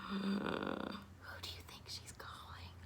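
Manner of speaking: soft conspiratorial whisper; breathy secret-sharing whisper to an audience; whispered question. Acted. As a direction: lean in and whisper it to the kids. Storyteller asks children a secret question.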